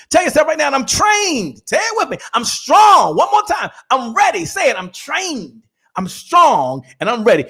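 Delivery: high, loud revival-style call; rally the crowd to repeat; fired-up preacher leading a chant; preaching call and response. Acted. Leads audience in call and response.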